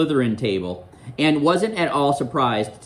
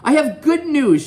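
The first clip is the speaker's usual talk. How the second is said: high-pitched announcement, much higher than the narration; McGonagall announcing; 13 semitones higher, 6 dB louder than usual. Acted. Professor making an announcement at breakfast, high announcing voice. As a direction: announce it high to a whole hall. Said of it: "made another announcement at breakfast".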